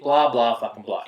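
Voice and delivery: normal talking voice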